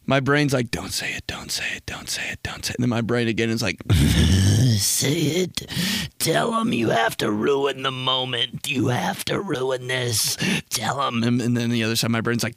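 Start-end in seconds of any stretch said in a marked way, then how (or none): 0.7-2.8 urgent whisper repeating "don't say it"; whispered inner voice. Acted. His brain whispering a warning at him. 3.9-11.2 a hint of a sly tempter; lightly voiced devil on the shoulder; tempting inner voice. His brain urging him to ruin the moment.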